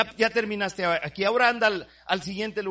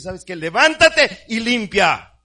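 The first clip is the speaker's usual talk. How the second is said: stern shouted order; 4 semitones higher, 10 dB louder, slower than usual. Retold or imitated. The Lord orders: clean up.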